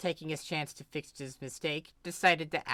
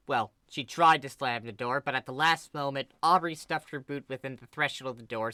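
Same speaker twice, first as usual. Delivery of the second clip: plain reading voice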